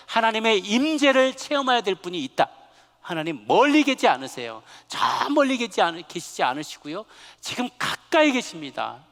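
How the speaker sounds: loud, high-pitched pulpit fervour; forceful preacher building to emphatic repetition; impassioned preaching. Real, not acted. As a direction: drive each phrase home at full voice.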